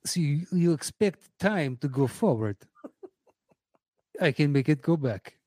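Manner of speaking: mock Russian accent with hushed start; exaggerated Russian accent; Kenneth Branagh. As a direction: do an over-the-top Russian film villain. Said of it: "please stop with the Russian".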